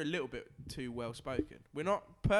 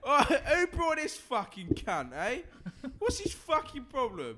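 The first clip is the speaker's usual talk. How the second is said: high, loud "Oi!" and a barking lout's snarl; aggressive pub lout; 10 semitones higher, 5 dB louder than usual. Acted. Drunk lout in his pub picking a fight, loud barking snarl. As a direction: bark it like a drunk looking for a fight. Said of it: "You imagine saying that in the pub".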